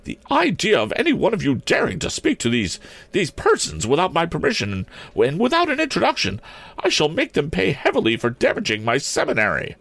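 high, scolding matron voice; outraged headmistress. A narrator's reading. Principal scolds pupils for speaking to strangers, outraged. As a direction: scold indignantly in a high schoolmistress voice.

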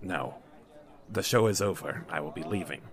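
very low, quiet voice; subdued low-voiced dismissal; curt patron refusal. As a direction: refuse softly and with finality, pitch dropped. Patron curtly refuses the manager's dinner.

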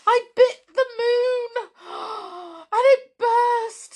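high, loud, trembling mouse cry; frightened stammer. Acted. Waffles the mouse to Pointy, shaken after the balloon burst. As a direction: blurt it out in shock and fear. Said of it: "stammered poor Waffles".

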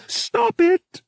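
very high, sharp protest; crying out. A narrator's reading. Bria to her brother Pavak, crying out. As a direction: cry out to cut him off. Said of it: "Bria cried".

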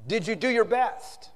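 pointed question. Retold or imitated. Admiral questions the young officer, slow and pointed.

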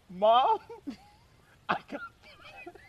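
high, slow mock whimper; reluctant confession. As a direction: squeak it out haltingly through a laugh. Confesses firing to mother, retold.

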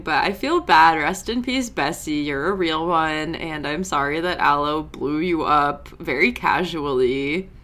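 usual speaking voice

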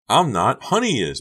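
high, loud shrieked retort. A narrator's reading. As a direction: shriek back in protest. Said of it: "Becca shrieked".

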